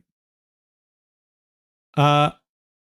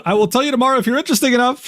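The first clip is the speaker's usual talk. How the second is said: higher, fast mocking singsong; mocking repeat; 7 semitones higher, much faster than usual. Streamer mocking a guy's smug DM, high and fast. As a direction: parrot his line back to ridicule it. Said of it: "He would say, Question mark? What the fuck?".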